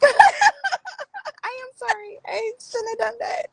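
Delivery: high-pitched laughing sorry; laughing apology. Real, not acted.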